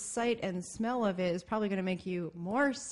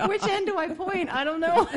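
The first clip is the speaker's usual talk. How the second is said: laughing panic; 9 semitones higher than usual. Retold or imitated. Acted panicked sick self.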